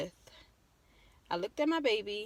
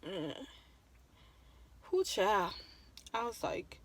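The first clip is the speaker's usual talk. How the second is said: ordinary talk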